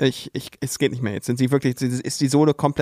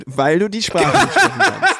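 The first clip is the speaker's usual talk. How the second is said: laughing tease; 6 semitones higher, 4 dB louder than usual. Real, not acted. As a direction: repeat it through laughter. Teasing repeat, laughing.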